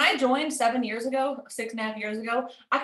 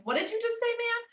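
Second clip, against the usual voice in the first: mock shock; 11 semitones higher, 4 dB quieter than usual.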